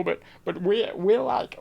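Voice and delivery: southern accent slipping away. Shown as a demonstration. Streamer losing the accent midway, faltering southern accent. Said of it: "oh, no, it's going".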